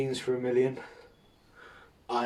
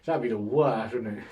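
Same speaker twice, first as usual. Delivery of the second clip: ordinary delivery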